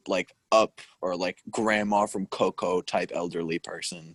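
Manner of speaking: normal talking voice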